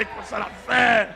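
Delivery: loud fervent preaching; shouting sermon. Real, not acted. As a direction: rouse the congregation. Preaching at full force.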